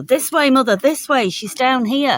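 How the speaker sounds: high, eager dog voice leading the way; Lassie voice. Retold or imitated. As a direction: voice the dog as Lassie, urgent and pleading. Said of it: "she was like Lassie".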